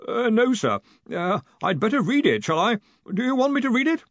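high, far-off timid voice; nervous voice from far away; timid distant voice. A narrator's reading. Collarbone voice.

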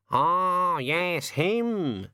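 a put-upon bird having a moan, slowly; raven voice. Acted. The raven speaks.

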